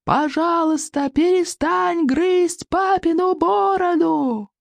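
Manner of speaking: high, stretched-out pleading yell. A narrator's reading. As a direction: strain high and call out every word slowly. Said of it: "Икинг заорал во весь голос".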